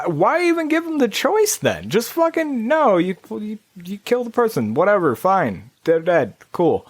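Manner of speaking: high, dismissive shrug of a voice; mock lazy developer. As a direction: toss it off like nobody cares. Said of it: "They're just like".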